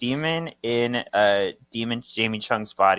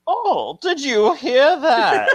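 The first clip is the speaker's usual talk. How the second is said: much higher, louder, slow startled lady; squeaky party lady; 13 semitones higher, 9 dB louder, slower than usual. Retold or imitated. Partygoer asks the crowd about the boom, high and startled. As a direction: play a shocked partygoer, very high. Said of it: "the lady's like".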